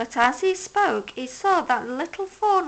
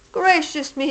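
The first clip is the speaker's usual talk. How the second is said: surprise; 4 semitones higher, 6 dB louder than usual. A narrator's reading. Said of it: "he said in surprise".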